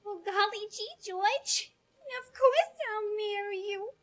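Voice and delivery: put-on old film ingenue. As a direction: sigh it like a 1940s ingenue. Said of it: "old films".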